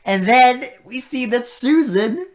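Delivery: loud, high, exasperated recap; incredulous outburst. Real, not acted. Co-host recapping absurd deaths, loud disbelief.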